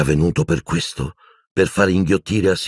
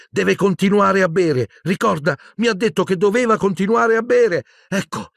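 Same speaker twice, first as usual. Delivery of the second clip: desperate insistence. A narrator's reading. Harry forcing the potion.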